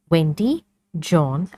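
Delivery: ordinary delivery, nothing marked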